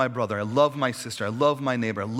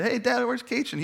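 put-on kid asking dad; 10 semitones higher than usual. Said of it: "we'd say".

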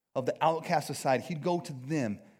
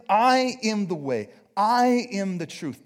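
arrogant claim; 4 semitones higher, 6 dB louder, slower than usual. Retold or imitated. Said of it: "that is a very arrogant thing to say".

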